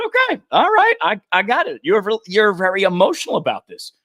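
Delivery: calm her down like a skittish animal; a volunteer placating a yelling homeowner; mock placating. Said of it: "So I said".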